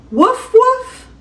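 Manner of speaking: high, yippy little puppy bark; squeaky cartoon puppy woof. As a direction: bark like a tiny playful puppy. Puppy barking in story.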